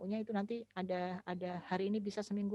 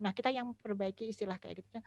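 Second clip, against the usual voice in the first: ordinary delivery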